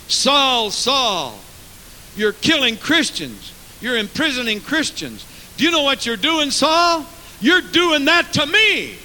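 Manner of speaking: Jesus confronting Saul. Acted. Preacher voicing Jesus calling out Saul, mounting reproach. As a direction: build to a loud, high charge. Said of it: "Jesus said".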